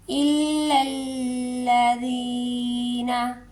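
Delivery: इल्लल्लज़ीना with both lams pressed and held; doubled lam held. Shown as a demonstration. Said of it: "थोड़ा मजबूती से पढ़ना है". (इल्लल्लज़ीना में दोनों लाम पर ज़ोर)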